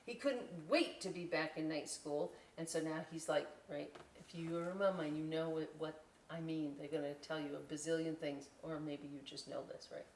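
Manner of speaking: ordinary delivery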